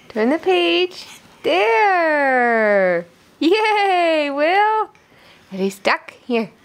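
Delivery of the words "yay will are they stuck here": usual speaking voice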